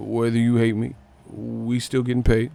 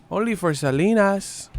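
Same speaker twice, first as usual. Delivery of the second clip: high, put-on film voice; mock movie quote; 8 semitones higher than usual. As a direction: imitate the line in a lifted voice. Mimics line from Selena film.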